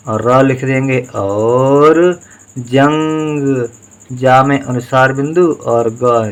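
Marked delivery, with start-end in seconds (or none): 1.1-3.7 very slow, stretched out; drawn out syllables. Shown as a demonstration. Teacher dictating a Hindi word to pupils, drawn out slowly. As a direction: sound it out slowly for the class. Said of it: "teacher sounds out word".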